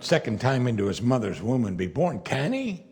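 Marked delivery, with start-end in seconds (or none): 2.2-2.8 sly retort. Acted. Nicodemus' retort.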